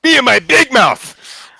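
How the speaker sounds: high, loud, drawn-out shout; loud boast. Shown as a demonstration. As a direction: yell it slowly, high above normal talk. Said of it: "I just yell out".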